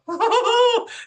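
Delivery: very high, loud giddy giggle; high pitched giggle. Shown as a demonstration. Showing how a spirit connection makes her giggle, very high giddy giggle. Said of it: "It's like a right high pitch giggle".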